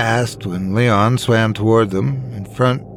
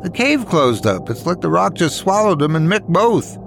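lightly voiced frustrated woman; 7 semitones higher than usual.

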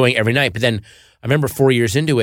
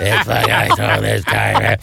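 slow imitation of a ranting actor; Lawrence Tierney rattling off; slower than usual. Actor ranting at the screen behind a moviegoer, imitated. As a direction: drag out the heckler's grumbled insults. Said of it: "it's Lawrence Tierney just rattling off".

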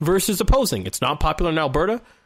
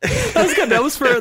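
delighted praise; 12 semitones higher, 5 dB louder than usual. Real, not acted. Host delighted by a co-host's quip.

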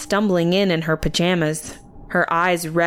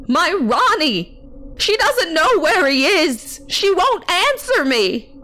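high-pitched wailing complaint; hysterical outcry; 12 semitones higher, 3 dB louder than usual. A narrator's reading. Aunt to Sue's parents, hysterical. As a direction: cry it out, far higher and raised.

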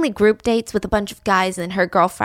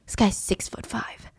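awed whisper; 8 dB quieter than usual. Acted. Repeated for emphasis.

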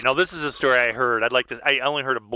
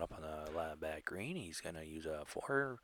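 restrained announcer, barely above a whisper; golf announcer; 7 semitones lower, 15 dB quieter than usual. Acted. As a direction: call the putt in a low, very soft murmur. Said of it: "That sounds like a golf announcer".

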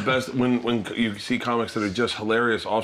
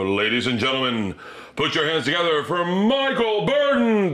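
stage announcer; 4 semitones higher than usual. Retold or imitated. Emcee bringing Mike Burton out in Vegas, announcer voice.

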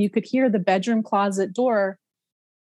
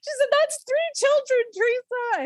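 much higher, breathless voicing of mom. Mom telling daughter about three children, high and shaken. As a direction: lift into a shaken mother's tone. Mom voiced, stunned, emotional.

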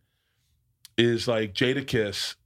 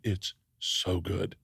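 hushed, slow, lower "so good"; soft, slow rave; 4 semitones lower, 5 dB quieter, much slower than usual. Retold or imitated. Roommate quietly urging him to watch the show, hushed. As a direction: savor it quietly, drawn out.

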